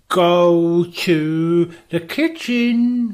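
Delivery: very slow, spooky instruction; mysterious note voice. Retold or imitated. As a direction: read it out like a ghostly clue. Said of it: "and it goes".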